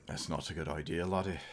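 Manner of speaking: lightly voiced Irish brogue; a soft mock Irish lilt; gentle Irish accent for Saint Paddy's. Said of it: "I'll do an Irish one".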